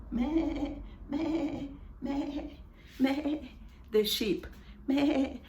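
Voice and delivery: lightly imitated sheep bleating.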